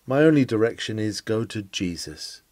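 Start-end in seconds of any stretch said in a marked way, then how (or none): none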